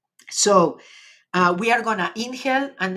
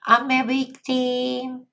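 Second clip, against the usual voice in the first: whiny victim voice; 4 semitones higher, slower than usual. Pretend. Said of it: "Or you're gonna".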